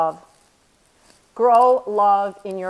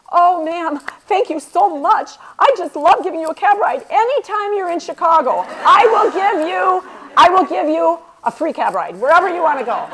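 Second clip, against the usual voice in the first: much higher and louder, gushing with a laugh at the end; grateful cab driver; 8 semitones higher, 4 dB louder than usual. Retold or imitated. Cab driver Armando thanking the speaker on the phone, high and gushing. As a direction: gush thanks like an overjoyed driver.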